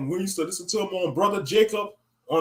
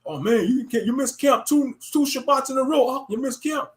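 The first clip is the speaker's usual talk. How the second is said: lightly raised, mocking street preacher; mocking camp member; 6 semitones higher than usual. Mocking a camp brother scolding him for missing camp.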